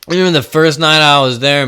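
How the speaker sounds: ordinary delivery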